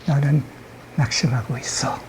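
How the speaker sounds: soft whisper into an ear; very gentle whisper. Acted. Imp whispering into a person's ear, very gently. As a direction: slip it in under the breath. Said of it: "아주 살짝".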